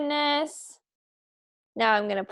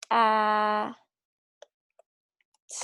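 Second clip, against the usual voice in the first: ordinary talk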